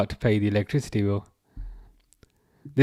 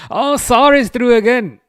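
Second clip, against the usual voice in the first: surprised; 10 semitones higher, 8 dB louder than usual. Real, not acted. Sudden reaction to match event.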